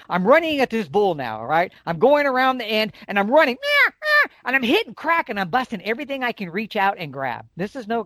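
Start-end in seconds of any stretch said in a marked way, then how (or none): 3.6-4.3 high squealing cow call; cow elk call. Retold or imitated. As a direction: mimic a cow elk mewing, pitched up. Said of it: "I'm making that cow sound".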